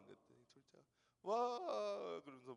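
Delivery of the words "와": delighted wow. Retold or imitated. Son gets fifty thousand won.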